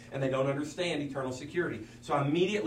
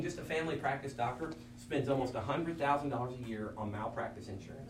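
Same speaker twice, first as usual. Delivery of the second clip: usual speaking voice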